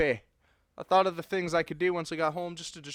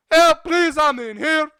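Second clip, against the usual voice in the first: high, loud pleading yell; shouting for help; 11 semitones higher, 11 dB louder than usual. Acted. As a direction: plead at full voice through the doors. Trapped, yelling for rescue.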